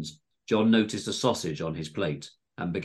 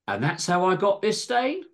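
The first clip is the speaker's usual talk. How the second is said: high singsong, rising at the end; punchline; 12 semitones higher than usual. A narrator's reading. John finishing his rhyme as Greg looks down, cheekily. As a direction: land it as a cheeky closing line.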